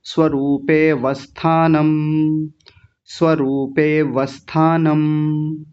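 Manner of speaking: स्वरूपे वस्थानम slowly, run together with no pause; slow but smooth, no gap between the words; joined, no gap. Shown as a demonstration. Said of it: "कैसे बोलेंगे".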